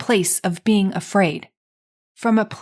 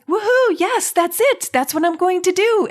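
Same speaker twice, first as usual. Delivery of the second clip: mock jubilant whoop; mock excitement; 9 semitones higher than usual. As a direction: bounce up like a gleeful fan, clearly put on. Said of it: "not jumping up and down with excitement".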